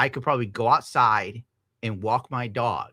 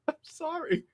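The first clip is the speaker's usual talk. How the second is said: squeaky mock apology; 7 dB quieter than usual. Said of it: "apology to the chat".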